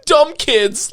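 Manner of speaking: slow, high mocking drawl with a laugh; mocking dumb look. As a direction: play it dumb and smug. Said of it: "this dumbass look like".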